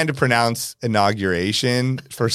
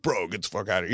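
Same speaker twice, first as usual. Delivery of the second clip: gruff bodyguard; 5 semitones lower, 10 dB quieter, faster than usual. Retold or imitated. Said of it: "He's like".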